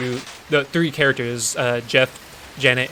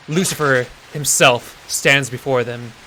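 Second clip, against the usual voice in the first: normal talking voice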